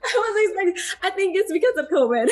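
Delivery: high, tearful laughing voice; choking up, laughing through tears; getting emotional. Real, not acted. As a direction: guest tearing up while thanking listeners. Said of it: "I'm getting emotional".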